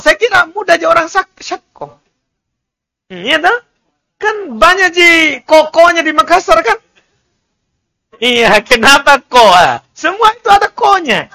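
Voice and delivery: ordinary talk, nothing marked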